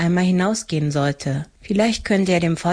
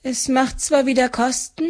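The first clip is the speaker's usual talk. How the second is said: relieved; 7 semitones higher than usual. A narrator's reading. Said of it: "Die Frau atmete erleichtert auf".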